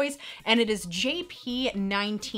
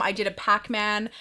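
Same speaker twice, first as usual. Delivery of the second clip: normal talking voice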